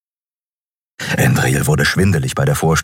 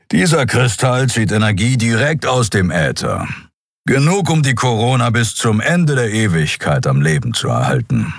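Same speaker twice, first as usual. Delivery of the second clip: lightly voiced gruff guide; a reserved explainer, matter-of-fact; Kero's voice; 3 semitones higher than usual. A narrator's reading. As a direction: Kero explaining the crystal to Endriel, lightly gruff. Kero explains the crystal.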